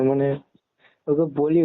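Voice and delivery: ordinary talk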